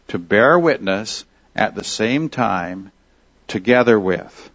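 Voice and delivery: phrase by phrase, with pauses to let each idea land; careful, deliberate repeat. Shown as a demonstration. Teacher repeating a literal translation for listeners, deliberate and spaced out. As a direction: say it carefully, pausing before "together with". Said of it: "now listen carefully".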